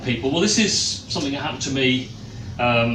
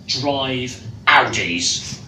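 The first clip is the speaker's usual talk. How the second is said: slow punchline; 3 semitones lower, much slower than usual. Acted. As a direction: drive Audis, each word drawn out slowly. Punchline of poem refrain.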